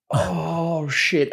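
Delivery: hushed, drawn-out dread; retold sinking feeling; daunted. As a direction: let it out softly, mock-daunted. Retold look over the ledge.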